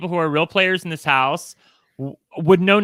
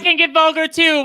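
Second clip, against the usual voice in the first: pitched way up, a mock-indignant squeal at "vulgar"; mock indignant retort; 11 semitones higher, 3 dB louder than usual. Son answers his mom about cussing, high mock-indignant retort. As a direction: push it high and indignant, playing outraged.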